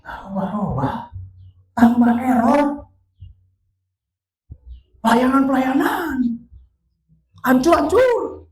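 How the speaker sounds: high, loud, stretched-out words; anguished lament. Retold or imitated. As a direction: wail each disaster out slowly. Piles up her ruin in anguish. (ratapan panjang bernada tinggi)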